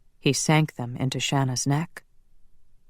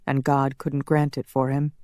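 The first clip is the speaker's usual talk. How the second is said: ordinary delivery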